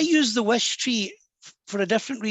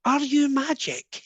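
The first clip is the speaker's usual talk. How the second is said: put-on slow, higher-pitched child with a Scottish accent; little Scottish boy; 6 semitones higher, slower than usual. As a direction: imitate a wide-eyed Scottish boy asking slowly. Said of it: "in his little Scottish accent".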